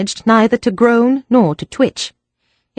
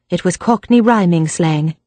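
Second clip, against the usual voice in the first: usual speaking voice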